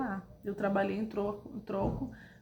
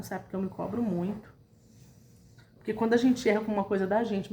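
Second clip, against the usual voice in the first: ordinary talk, nothing marked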